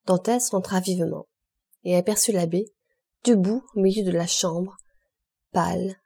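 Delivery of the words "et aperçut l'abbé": usual speaking voice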